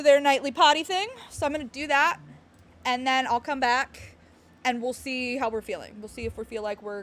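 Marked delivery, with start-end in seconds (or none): none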